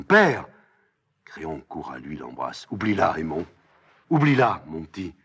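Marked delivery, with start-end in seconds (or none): 0.0-0.5 loud, high, falling cry. Acted. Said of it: "Aimon hante en criant". 2.7-5.1 firm plea rising louder and higher; urgent insistence. Acted. Créon retient son fils.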